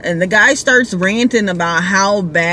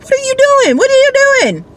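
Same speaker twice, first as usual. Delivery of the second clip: much higher, alarmed repeated question; panicked drunk rider; 5 dB louder, much faster than usual. Retold or imitated. Drunk rider panicking as the driver changes lanes, high alarmed. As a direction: imitate a drunk guy suddenly panicking.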